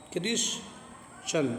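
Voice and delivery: क्रिश्चन whispered slowly with ch. Shown as a demonstration. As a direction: breathe out क्रिश्चन slowly, ch sound. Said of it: "तो चा रहेगा".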